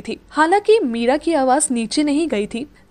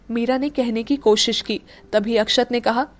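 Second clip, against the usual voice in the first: ordinary delivery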